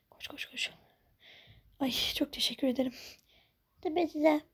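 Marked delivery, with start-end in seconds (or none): 0.1-0.7 hushed rapid urging; urgent whisper. Acted. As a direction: hurry them silently. Hurrying the stranger.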